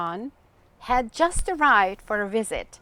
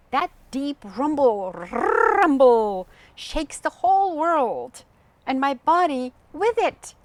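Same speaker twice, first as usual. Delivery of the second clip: louder, rumbling out the thunder; booming thunder voice; 4 semitones higher, 6 dB louder than usual. Acted. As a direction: make the rumble roar through the words. Bo describes thunder shaking him.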